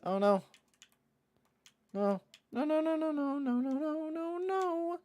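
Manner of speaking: very high, strained stream of no's; panicked no's. Real, not acted. Streamer watching his puck get stolen, panicked squeal. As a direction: wail as the play goes wrong.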